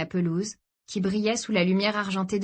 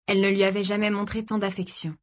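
usual speaking voice

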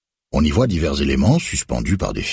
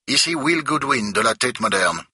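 stiff official; 10 semitones higher than usual. A narrator's reading. Museum director to Becker on the phone, prim and high. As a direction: introduce yourself primly.

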